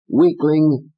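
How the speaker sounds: high sneer; Fats. Acted. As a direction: spit the insult. Fats taunts.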